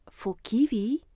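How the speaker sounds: mildly astonished rising question; surprised. A narrator's reading. Mother seeing the pile of drawings, surprised question. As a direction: echo it back with a lift at the end. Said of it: "zegt zijn moeder verbaasd".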